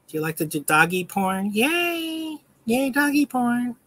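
put-on high, singsong baby talk to a dog; cutesy pet-owner voice teasing the dog. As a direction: coo at the puppy like a doting owner.

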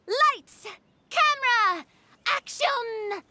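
high-pitched call for another take; film director starting the take. Acted. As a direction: call out each cue high, with falling tune. Director calls a second take.

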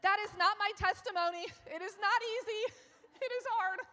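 a comic outcry breaking into laughter; standing up in protest. Retold or imitated. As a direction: daughter protesting to the room, loudly. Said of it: "I just stood up and said".

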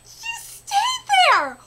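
high, loud, slow fangirl squeal; excited squeal. Real, not acted. Fan squealing at her favourite character on screen. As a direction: squeal it out in delight.

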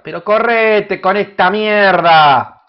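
loud, slow, irritated yell; annoyed shout. Real, not acted. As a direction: snap at it to get out of the way. Annoyed at something blocking.

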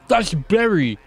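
high, loud cry of recognition; excited outburst. Acted. Character spots friend on the TV news, excited outburst. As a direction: burst out at spotting a friend, pitched high. Interrupts the news report in surprise.

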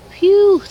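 a sharp, loud blow-out of relief; loud relieved phew. Real, not acted. Gamer escaping angry pigmen, loud relieved phew. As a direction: puff it out like you just escaped. Fleeing angry mobs in game.

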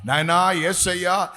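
high, loud, building intercession; fervent shouted prayer. Real, not acted. Pastor cries out to God for fire and boldness in a loud prayer.

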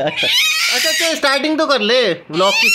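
high, loud, half-laughing protest. Real, not acted. Uncle shouts at toddler grabbing his camera, playful loud protest.

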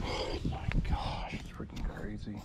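slow whisper of disbelief; awestruck whisper. Real, not acted. Angler after the bass ran over his line, stunned whisper. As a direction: whisper it, overwhelmed.

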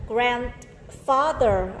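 slow, drawn-out Grandfather; teacher modelling Grandfather slowly; slow model word. Shown as a demonstration. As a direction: spell out Grandfather syllable by syllable. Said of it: "grandfather là".

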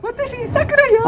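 put-on high sweet girlish voice; high cute anime girl. Shown as a demonstration. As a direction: chirp it like an anime heroine. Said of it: "さくらちゃん、どんな声だと思う？".

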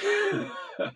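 a sudden high laugh bursting out; amused laugh before the punchline; burst of laughter. Real, not acted. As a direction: break into a loud laugh. Laughing at own joke.

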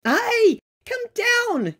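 high squeaky yell; shrill little creature shout; brownies yelling. A narrator's reading. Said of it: "yelled the brownies".

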